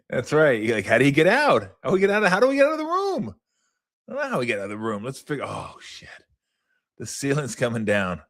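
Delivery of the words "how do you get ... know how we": high, fast panicky voice; mock panicked game character. Gamer voicing the trapped character in panic. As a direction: squeal the escape plea up high.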